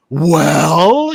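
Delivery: mock an impatient crowd waiting for the show; imitating expectant audience. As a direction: stretch it out as a prompt. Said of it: "staring you in the face going".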